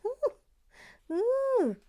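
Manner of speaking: high, drawn-out ooh; a high cheeky gasp of delight; mock-scandalised ooh. Real, not acted. Reacting to the hens and chicks line with a high scandalised ooh.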